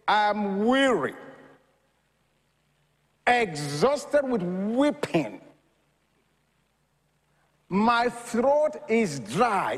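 slow and drawn out, each phrase weighed; slow, weighty quote. Retold or imitated. Preacher quoting David's lament to the congregation, slow and weighty. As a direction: let the psalm's words sink in slowly. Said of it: "he says".

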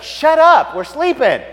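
high, loud complaint from sleepers; annoyed townspeople. Acted. Woken townspeople to hollering shepherds, annoyed yell. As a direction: yell at the noisy revellers to quit. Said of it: "Everybody's like".

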